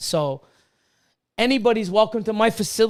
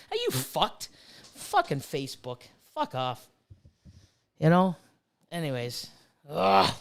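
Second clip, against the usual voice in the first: usual speaking voice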